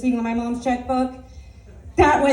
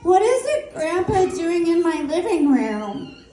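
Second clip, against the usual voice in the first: put-on kid's voice; 7 semitones higher, slower than usual. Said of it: "She's like".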